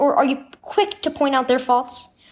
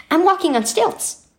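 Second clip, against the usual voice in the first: high, proud announcement; 8 semitones higher than usual. A narrator's reading. Boy shows his mom he's walking on stilts, proudly. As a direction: show off the new skill with pride. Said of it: "he said proudly".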